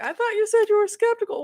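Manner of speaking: high, fast teasing voice; quick high-pitched mock accusation; teasing quote. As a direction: needle her about it. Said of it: "It's like".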